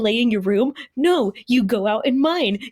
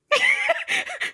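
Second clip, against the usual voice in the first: short, very high laugh; high squeal of laughter; real laughter. Real, not acted. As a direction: burst out in a high giggle. Laughing at the fence argument.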